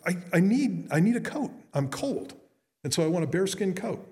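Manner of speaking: high-pitched, lightly acted hunter; hunter in a joke. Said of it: "And he goes".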